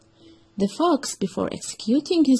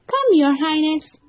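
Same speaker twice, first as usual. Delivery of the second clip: high, louder eager fox voice; fox explaining; 6 semitones higher, 4 dB louder than usual. Acted. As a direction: lure him with grand promises. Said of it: "said the fox".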